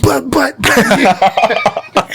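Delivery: high, insistent butting in, half laughing; mock interrupting. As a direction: imitate yourself talking over someone. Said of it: "you would be saying something".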